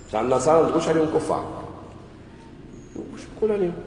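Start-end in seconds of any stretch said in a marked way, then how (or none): 3.0-3.7 mock questioner. Preacher voices a questioner.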